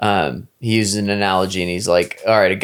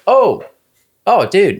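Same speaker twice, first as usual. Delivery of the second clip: much higher, louder "oh, dude"; mock dismayed sympathy; 11 semitones higher, 5 dB louder, slower than usual. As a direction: react like a friend shocked to see you stuck. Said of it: "And the guy's like".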